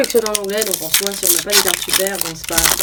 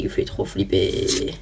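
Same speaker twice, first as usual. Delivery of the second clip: low breathy whisper, slowed down; hushed mock-scary voice; mock spooky whisper; 12 semitones lower, 6 dB quieter, slower than usual. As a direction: whisper it like a ghost story. Mock spooky aside on scary card.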